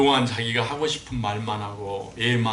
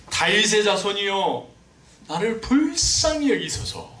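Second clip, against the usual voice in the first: slow, higher and louder plea; blind man crying out; 7 semitones higher, 5 dB louder, slower than usual. Retold or imitated. Preacher voicing the blind man calling to Jesus, loud plea. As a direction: call out slowly for mercy. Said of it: "소리를 칩니다".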